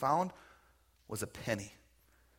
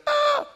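loud, very high yelp of pain; hammed-up little girl's scream; mock child scream; 15 dB louder than usual. Uncle pulls niece's stuck arm, hammed-up high scream.

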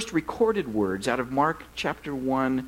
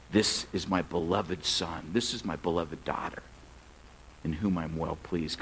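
tender pronouncement; 10 semitones lower, 7 dB quieter than usual. Acted. God speaking over his beloved children, low and tender. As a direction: drop low and quiet, as a father affirming his child. Voicing God's words over believers.

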